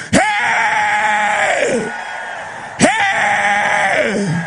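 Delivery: long, high shouted Hey; preacher's war cry. Real, not acted. Preacher challenging demons, high drawn-out shout.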